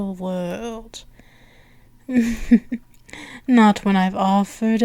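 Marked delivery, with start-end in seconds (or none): 2.1-2.8 indulgent chuckle. Acted. Princess having offered the world, indulgent little chuckle. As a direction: chuckle briefly between phrases.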